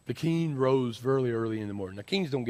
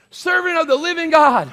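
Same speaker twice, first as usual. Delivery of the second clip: high, loud proclamation; yelling king; 12 dB louder than usual. Acted. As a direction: cry it out as the king. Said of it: "he starts yelling".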